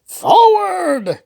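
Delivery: very high battle cry; screamed. Acted. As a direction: charge into battle. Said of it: "King Hubert screamed".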